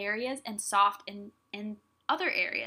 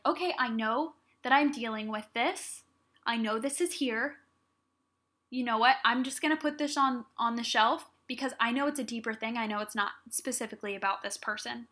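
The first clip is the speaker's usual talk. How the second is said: ordinary delivery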